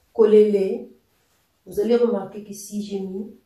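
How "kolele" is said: KOLELE much louder, with a final rise; question intonation. Shown as a demonstration. Teacher repeats the question "Kolele" for learners, loud rising intonation. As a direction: project "Kolele" as a clear question. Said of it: "c'est une question".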